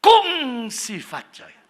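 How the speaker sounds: high, loud, drawn-out greeting; ringing Hakka greeting, pitched high and slow; high Hakka intonation. Shown as a demonstration. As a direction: launch high and loud on "Kung", stretching it. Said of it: "Tinggi sudah".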